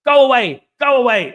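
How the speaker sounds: loud shout of go away; shooing away. Pretend. Acting out scaring off a bear with a loud shout.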